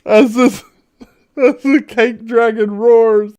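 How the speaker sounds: very high, put-on storyteller voice; high-pitched narration. Game master unveils the cake dragon, high-pitched narration. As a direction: narrate it all up in a squeaky register.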